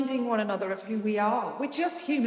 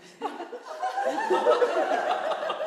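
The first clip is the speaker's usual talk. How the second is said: very high tipsy giggle; a bubbly, very high giggle; champagne giggle; 4 dB louder than usual. Shown as a demonstration. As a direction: giggle as the bubbles go to your head. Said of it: "a little bit of a giggle".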